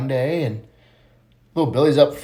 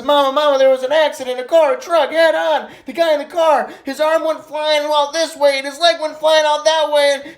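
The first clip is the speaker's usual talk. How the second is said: put-on high, urgent little-boy voice; frantic child reporting; 11 semitones higher than usual. Johnny running home to tell Mama about the crash, frantic. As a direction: blurt it out in an acted panic.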